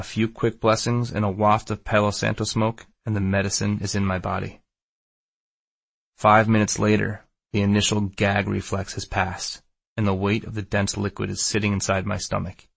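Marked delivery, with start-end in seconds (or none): none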